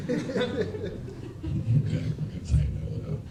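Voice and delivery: breathy laugh, words barely voiced; laughing aside. Real, not acted. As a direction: chuckle it off under your breath. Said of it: "aside after a bombed joke".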